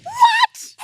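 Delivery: squealed very high and loud; shocked shout. Real, not acted. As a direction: cry out in disbelief. Shocked at the bird call.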